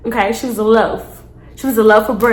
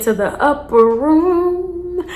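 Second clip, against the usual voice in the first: mock heavenly voice; 5 semitones higher, much slower than usual. Mocking girl's wish to die.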